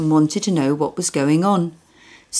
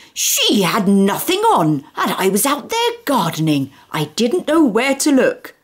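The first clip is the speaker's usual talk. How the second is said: indignant rant; 6 semitones higher than usual. Acted. Mister Tugg ranting.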